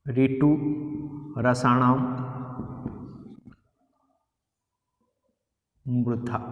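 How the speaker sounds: each word of the formula spaced out with long pauses; spelled-out dictation, word by word; slow dictation. Shown as a demonstration. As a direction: dictate slowly so students can write. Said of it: "इसका सूत्र लिख देता हूं".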